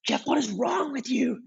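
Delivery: high, yelling berating coach; shrill-free high-pitched frantic scolding from the sideline; coach losing his mind. Retold or imitated. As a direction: scold the goalie like a coach who's lost it. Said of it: "his coach is just losing his mind".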